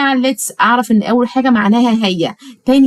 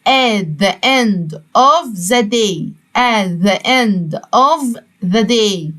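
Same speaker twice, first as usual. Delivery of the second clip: at the end of the day drawn out very slowly; slow model phrase; much slower than usual. Shown as a demonstration. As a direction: say the whole phrase slowly for repeating. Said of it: "في نهاية اليوم". (at the end of the day ببطء شديد)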